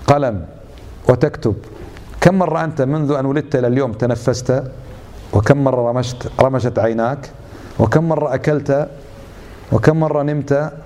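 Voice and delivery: ordinary talk, nothing marked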